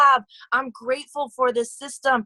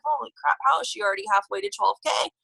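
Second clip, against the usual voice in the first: mock shock; 9 semitones higher, faster than usual. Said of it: "I'm like".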